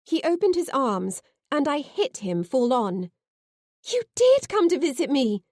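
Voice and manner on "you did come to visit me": breathless, higher and louder joy; gasped out. A narrator's reading. Girl reunited with her sweetheart, gasping with joy. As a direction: gasp it out overjoyed. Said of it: "I gasped".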